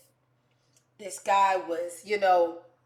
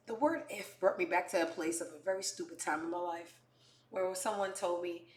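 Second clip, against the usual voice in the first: ordinary delivery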